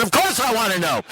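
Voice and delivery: high-pitched, desperate mock plea; hammed-up impatient pleading; begging. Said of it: "Now I'm begging him".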